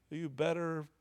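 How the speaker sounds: usual speaking voice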